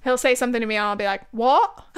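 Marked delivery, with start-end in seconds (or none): 1.3-1.8 put-on loud British-accented "What?". Teasing Nathan with her favorite word, loud British accent. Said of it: "is my favorite thing to say".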